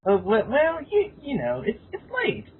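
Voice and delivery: usual speaking voice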